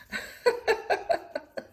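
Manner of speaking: breathy high little laugh; quick amused airy giggle. Real, not acted. Laughing at own remark.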